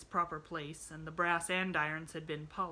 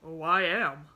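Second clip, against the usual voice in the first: loud, grimly sarcastic reply; grim retort; 10 dB louder than usual. A narrator's reading. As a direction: throw it back grimly, loudly. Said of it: "retorted the man grimly".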